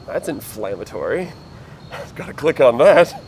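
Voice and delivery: amused reaction. Retold or imitated. Telling own reaction to a shocking headline, amused. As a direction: laugh your way into an eager resolve.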